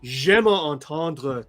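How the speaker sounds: careful Franco-Ontarian French, every word enunciated. Shown as a demonstration. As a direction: speak Franco-Ontarian French, crisp and deliberate. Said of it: "I speak French with, like, this".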